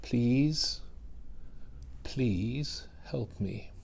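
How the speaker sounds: soft, slow, broken pleading; desperate plea. Acted. Kneeling mother begs Jesus to heal her child, softly pleading. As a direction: beg quietly for your child. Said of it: "she's kneeling, she's desperate".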